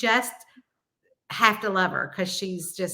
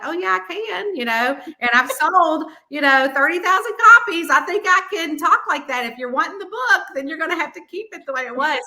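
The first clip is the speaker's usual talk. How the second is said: higher, sassy comeback, louder on the sales figure; defiant retort; 7 semitones higher, 5 dB louder than usual. Retold or imitated. Author standing up to the publisher, sassy and defiant. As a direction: talk back with cheeky confidence. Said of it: "I'm like".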